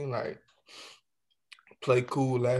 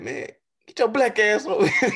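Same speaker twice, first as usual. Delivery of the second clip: higher, louder rude customer voice; 9 semitones higher, 5 dB louder than usual. Retold or imitated. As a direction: shout him over like a rude customer. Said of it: "What he said".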